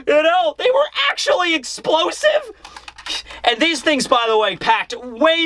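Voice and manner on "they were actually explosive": high-pitched, whiny mock protest; Yamato's tearful disbelief. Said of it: "Yamato is crying".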